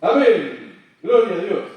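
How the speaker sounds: shouting amen after rebuking the storm; loud amen. Retold or imitated. Pastor closing his command.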